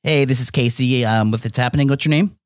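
deep slow producer voice. Pretend. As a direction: play a creepy radio producer. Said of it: "People think this is the wrong number".

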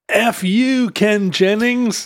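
high, slow mock contestant cry; mock outburst. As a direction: draw out a pretend curse at the host. Imagined contestant swearing at host.

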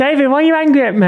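high, fast, hammed-up whiny plea; mock the friend's panicked dream voice; high and fast, a teasing send-up of a friend; mocking impression. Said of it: "mock voicing friend in dream".

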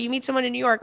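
usual speaking voice

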